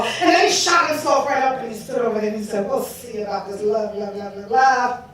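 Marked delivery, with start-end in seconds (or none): 2.7-5.0 low, soft, dismissive repetition; mock the word as you trail along low; scornful muttering. God plotting against the goddess, low scornful mutter.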